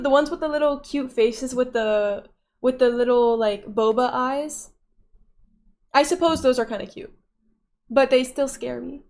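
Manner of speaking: normal talking voice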